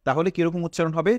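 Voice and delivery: normal talking voice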